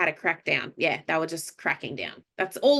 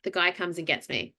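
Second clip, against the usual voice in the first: ordinary delivery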